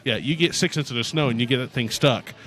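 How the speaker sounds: ordinary talk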